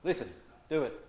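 sudden loud command, barked; commanding shout. Shown as a demonstration. Teacher demoing a command to the class, loud. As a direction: snap an order at them. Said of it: "And the A U is".

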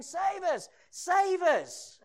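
loud, high cry for rescue; desperate mock cry. Sailors calling on their idols, desperate shout. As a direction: wail it out to deaf gods. Idolaters crying to their gods.

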